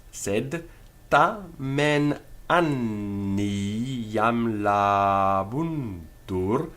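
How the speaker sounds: drawn-out Latin verse, syllable by syllable; very slow measured reading, every long vowel held; extremely slow read-through. Shown as a demonstration. Teacher reads Latin verse through for students, extremely slowly. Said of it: "extremely slow".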